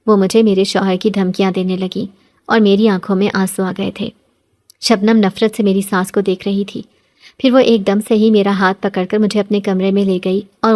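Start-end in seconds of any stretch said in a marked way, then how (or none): none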